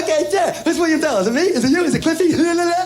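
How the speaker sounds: high, giddy child impression; overjoyed little kid. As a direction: babble happily like a kid let into the game. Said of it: "I was just so happy".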